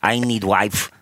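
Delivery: thick mock Russian accent in broken English; choppy Russian-accent phrases, building to an exclamation; Vladimir Tarasenko. Russian player begging fans for money and a wife, hammed-up Russian accent. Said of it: "that's Vladimir Tarasenko".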